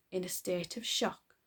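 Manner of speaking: usual speaking voice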